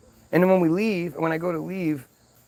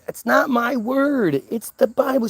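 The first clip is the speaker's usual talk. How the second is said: high, slow, pointed correction; retold insistence; 8 semitones higher, slower than usual. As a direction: quote yourself insisting, drawn out. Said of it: "when I said".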